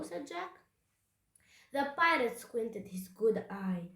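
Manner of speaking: usual speaking voice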